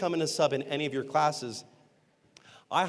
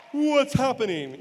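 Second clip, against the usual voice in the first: higher, louder, slow confused question; baffled students; 7 semitones higher, 5 dB louder, slower than usual. Retold or imitated. Students reacting to the sub's shock method, baffled. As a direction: sound lost and thrown.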